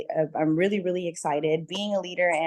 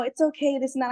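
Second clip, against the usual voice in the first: higher, sugary mock reassurance; mock people-pleasing; 7 semitones higher than usual. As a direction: play the pushover who lets it slide. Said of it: "people pleased and just been like".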